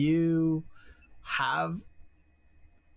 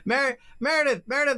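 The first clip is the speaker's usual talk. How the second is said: high, loud call for attention; 11 semitones higher, 5 dB louder, faster than usual. Real, not acted. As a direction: call her name across the table. Calling next player's turn.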